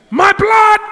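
high, loud shout; impassioned pulpit shout; preacher's cry. Acted. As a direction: cry out the blood's plea. Climax of sermon cry.

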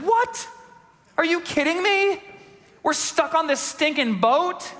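high, whining outrage at being stuck; whiny complaining relative. Retold or imitated. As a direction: squeal it like a spoiled passenger. Relative complaining about lost port.

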